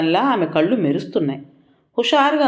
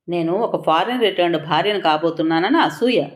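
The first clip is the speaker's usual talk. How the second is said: usual speaking voice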